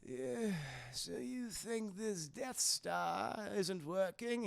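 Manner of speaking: slow, soft sinister voice; tempting devilish whisper. Acted. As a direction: drawl it like an evil emperor. Palpatine mocks Luke.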